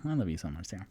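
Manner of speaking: normal talking voice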